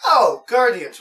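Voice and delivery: high, loud "oh, Guardians" of recognition; like it just dawned on you; mock surprise. Seeing the loot crate theme posted online, a surprised oh. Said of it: "And I was like".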